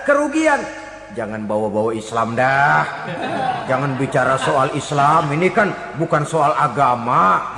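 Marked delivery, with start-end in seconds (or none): 1.1-7.5 much lower-pitched, dismissive imitation; mock dismissiveness. Opportunist disowning Islam when loss looms, mock dismissiveness. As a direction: play someone backing out, voice dropped low. Mimics opportunists backing away from religion.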